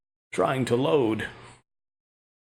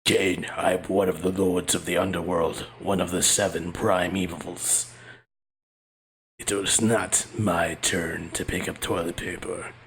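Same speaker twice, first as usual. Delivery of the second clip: demon voice; 10 semitones lower, 4 dB quieter than usual. Acted. Voicing Mephisto the demon.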